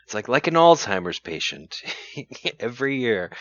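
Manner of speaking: ordinary delivery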